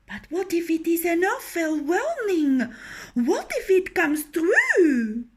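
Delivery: wife begging her husband to take the warning seriously, high and fearful; fretful hen. Acted. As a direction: plead in fear that the dream comes true. Hen fearing her dream.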